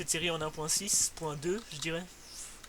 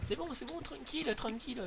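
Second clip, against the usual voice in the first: reassuring; 9 semitones higher, 4 dB quieter than usual. Real, not acted. Calming panicked friend.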